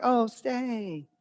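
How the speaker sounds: put-on, high-pitched hospitality; insincerely polite. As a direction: coo a polite offer you don't mean. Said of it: "you don't really mean it".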